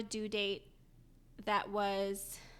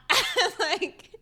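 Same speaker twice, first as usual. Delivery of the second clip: laughing through tears; 10 semitones higher, 10 dB louder than usual. Real, not acted. Crying and laughing retelling.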